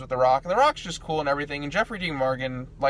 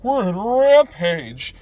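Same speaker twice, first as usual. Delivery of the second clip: loud, drawn-out shout pitched way up; over-the-top crazy newscaster; 7 semitones higher, 9 dB louder, much slower than usual. Retold or imitated. Imitating the game's newscaster losing it, over-the-top crazy shout. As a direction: yell it like a newscaster gone crazy. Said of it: "like, over the top crazy".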